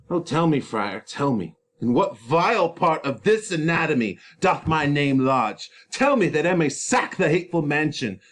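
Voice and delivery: loud, raw Romeo demanding answers; desperate Romeo. Acted. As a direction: demand it, ready to cut. Romeo in despair.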